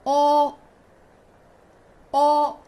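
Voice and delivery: teacher models O loudly for class; pinyin o. Shown as a demonstration. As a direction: round the lips for a held O. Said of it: "Read after me".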